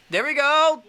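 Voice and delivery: high, loud triumphant cheer; excited cheer. Real, not acted. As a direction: celebrate the hit. Good hit in the game.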